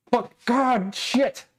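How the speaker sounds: high, exasperated burst of swearing; frustrated cursing. Real, not acted. Facing a whole shelf reshuffle, cursing high and exasperated. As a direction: blurt the curses as it dawns on you.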